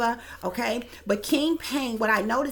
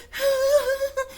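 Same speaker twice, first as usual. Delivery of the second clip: a high, thin mock sob; imitated weeping whimper; 4 dB louder than usual. Retelling the video, he mimics her weeping with a high whimper. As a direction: squeeze out a high whimpering cry. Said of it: "she starts weeping".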